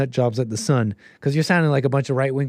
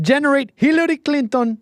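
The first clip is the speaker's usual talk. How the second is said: higher-pitched attempt at a Russian Putin voice, barely Russian; failed Russian accent; 11 semitones higher than usual. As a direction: bark orders like Putin in a bad Russian accent. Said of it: "that's probably not a Russian accent".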